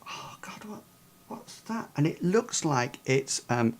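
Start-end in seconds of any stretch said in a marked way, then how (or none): 0.1-1.9 hushed, frightened whisper; scared whisper. Pretend. Player peering at the corpse, a frightened whisper. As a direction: breathe it out in fear.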